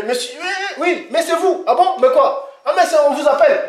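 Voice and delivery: "oui, mais c'est vous", high and laughing, with quick amazement; surprised official. Retold or imitated. [name] acts out a Chinese official, high and laughing in surprise. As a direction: play the baffled Chinese host, high-pitched and giggling. Acted exchange about name in China.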